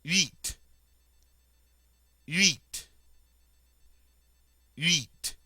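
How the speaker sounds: Huit starting with the rounded French U glide, H silent. Shown as a demonstration. Teacher modeling huit for students, rounded French vowel.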